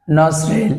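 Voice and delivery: নজরেল said as "nosrel", no t; নজরেল as "nos-rel", silent t; nostril without the t. Shown as a demonstration. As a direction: say নজরেল with the t left out. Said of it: "এখানে টিটা উচ্চারণ হয় না".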